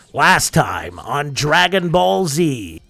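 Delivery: slow, hammed-up anime narrator; mock dramatic announcer intro; previously on recap. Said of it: "That was a horrible impression".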